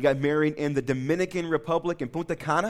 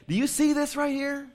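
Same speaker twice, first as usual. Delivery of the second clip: high, light voice of a cheerful nurse; 12 semitones higher than usual. Retold or imitated. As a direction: lift into a bright nurse voice.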